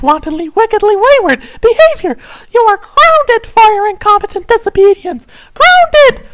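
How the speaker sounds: fast, low, clipped lecture, punching a final Grounded; stern scary parent. Acted. Tinkerbell scolds Tavros for sneaking out, stern and rapid. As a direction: scold the kid rapid-fire, then slam down Grounded. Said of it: "repetitioned curtly and scarily".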